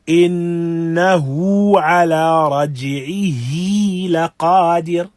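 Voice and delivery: slow recitation, lengthening the ه of رجعه and إنه by two counts; Quran recitation. Shown as a demonstration. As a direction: chant إنه على رجعه لقادر slowly, stretching each final ه. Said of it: "giving emphasis to these two places".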